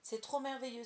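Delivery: thrilled exclamation; exclaiming. A narrator's reading. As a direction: burst out delighted. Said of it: "s'écria-t-elle".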